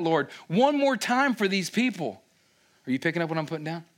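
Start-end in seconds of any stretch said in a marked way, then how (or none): 0.0-2.2 Moses crying out. Retold or imitated. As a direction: cry out for the people. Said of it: "Moses is crying out".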